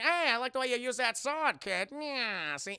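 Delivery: hammed-up old-time gangster voice; Lil Capone. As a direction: talk like a cocky movie mobster. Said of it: "And Lil Capone's like".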